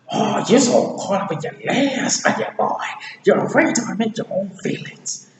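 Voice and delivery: high, loud mock scolding; Donkey scolding. Voicing Donkey confronting Shrek, scolding.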